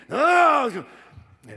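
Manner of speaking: high, loud imitated shout of refusal; hard-nosed father refusing. Father overruling his pleading son, acted angry shout. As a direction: play a tough dad refusing point-blank. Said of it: "hard-nosed character".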